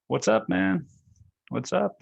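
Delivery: high, casual bro greeting; a lightly voiced upbeat greeting; mock bro greeting. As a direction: toss off a hey-man hello. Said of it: "that's what I say".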